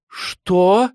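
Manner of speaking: high, loud disbelieving yelp; stunned, piercing "what?!"; shocked outcry. A narrator's reading. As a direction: blurt it out in high alarm. Mother shocked at the news.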